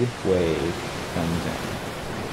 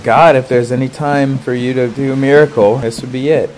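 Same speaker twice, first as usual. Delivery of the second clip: raised, higher-pitched retold plea; pleading prayer; 5 semitones higher, 6 dB louder than usual. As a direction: call up to God urgently. Praying for a miracle.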